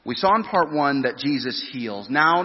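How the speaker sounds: usual speaking voice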